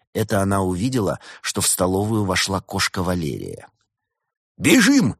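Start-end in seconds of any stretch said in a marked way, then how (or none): 4.6-5.2 high, loud burst of panic; panicked call to flee; frightened cry. A narrator's reading. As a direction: yelp it in alarm. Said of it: "испугался Проспер".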